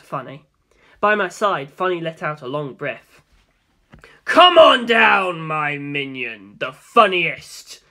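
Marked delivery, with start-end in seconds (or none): 4.2-7.8 slow, drawn-out loud call; booming summons. Acted. Dio summoning his minion from the helicopter, booming call. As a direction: call him down like a showman. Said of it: "D L said".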